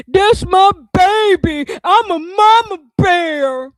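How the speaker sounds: high, loud, slow whiny mock voice; mocking mama bear. Mocking the mother's tearful video: high, loud, slow fake sob. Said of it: "You was on here".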